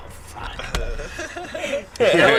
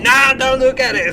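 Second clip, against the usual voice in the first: scolding mom; 5 semitones higher, 5 dB louder than usual. Retold or imitated. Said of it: "your mom".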